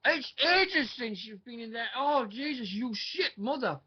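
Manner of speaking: high, slow, furious ranting; outraged rant. Real, not acted. Outraged rant at tag team pick.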